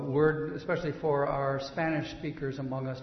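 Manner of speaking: ordinary talk, nothing marked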